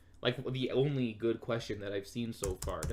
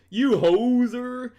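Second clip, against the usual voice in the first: high, loud, drawn-out mock insult; mock-shouted slang insult, stretched out; playful yell; 12 semitones higher, 12 dB louder, much slower than usual. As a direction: holler it out long for fun. Playfully calling out Canadian slang insult.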